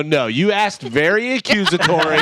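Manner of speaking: playfully indignant husband; insistent rebuttal. Retold or imitated. Retold bickering with wife.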